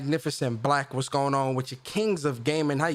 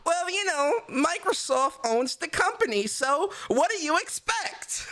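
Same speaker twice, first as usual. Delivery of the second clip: mocking Twitter commenter; 11 semitones higher than usual. Streamer mocks a Twitter comment for a soundbite, high sneering voice. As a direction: ham up a smug know-it-all from Twitter. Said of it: "this is the ones that people love to say on Twitter".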